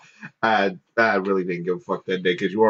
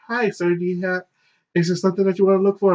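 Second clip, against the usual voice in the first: high-pitched, quick girl's voice imitation; little girl clerk; 8 semitones higher, faster than usual. Girl clerk offers a shopper help, high imitation. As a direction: put on a high young shop-assistant voice. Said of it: "little girl comes up to me and she goes".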